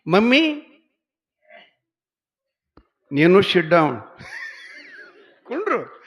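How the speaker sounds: put-on high, loud little boy bossing his mother; shrill it out like a bossy toddler; child's demand. Small boy loudly ordering his mummy to sit down.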